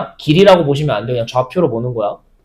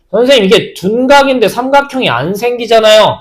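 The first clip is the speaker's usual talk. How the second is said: higher-pitched student raising an objection; lightly acted puzzled pupil, pitched up; objecting student; 7 semitones higher than usual. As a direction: imitate a pupil protesting to the teacher. Teacher voices a student's objection.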